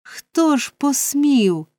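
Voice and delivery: high, slow outraged question; indignant cry. A narrator's reading. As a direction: cry out in affront, stretching the words. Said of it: "скрикнув містер Бамбл".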